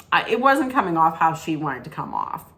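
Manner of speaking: ordinary talk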